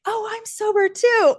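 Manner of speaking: high, bright mock bartender; a bartender happily sharing news; chirpy bartender. Bartender to her at the bar, high and chirpy.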